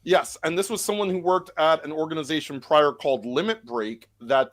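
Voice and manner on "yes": normal talking voice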